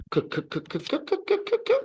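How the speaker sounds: repeated crisp K sounds, K K K; K sound demo. Shown as a demonstration. Teacher drills the letter C as K. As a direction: clip out K, K, K in a quick row. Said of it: "the first sound will be the sound K".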